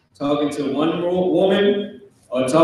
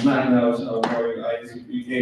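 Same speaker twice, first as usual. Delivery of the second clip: lower, softer, quick recitation; kids' corrected answer; 5 semitones lower, 6 dB quieter, faster than usual. Acted. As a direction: act kids saying it meekly, low and fast. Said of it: "You better say it like that".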